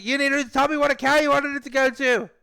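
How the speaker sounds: high, rapid-fire angry yelling; imitating a caller screaming at him; screaming support guy. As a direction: scream the accusation in one garbled rush. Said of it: "guy from DoorDash screamed at me".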